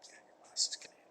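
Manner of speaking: fast hushed confirmation; quick whispered reply. Pretend. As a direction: confirm the awful truth in a rushed whisper. Acted reply in dialogue.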